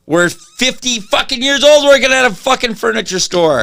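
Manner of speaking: high, mock-bitter outburst about being fifty; frustrated rant. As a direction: spit it out like a fed-up salesman. Venting about the dead-end job.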